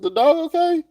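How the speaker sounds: imitating his own panicked high voice; worried retold question. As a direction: ask high and urgent if the dog is fine. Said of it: "I said".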